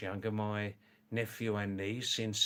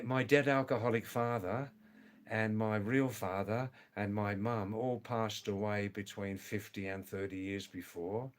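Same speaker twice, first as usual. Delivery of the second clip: ordinary delivery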